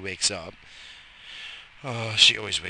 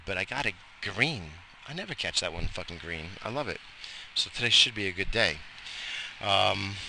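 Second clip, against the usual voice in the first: normal talking voice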